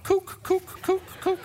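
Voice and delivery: very high, repeated mock alarm beeps; imitated alarm. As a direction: hoot it like a warning meter going off. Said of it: "My kook meter should have went off".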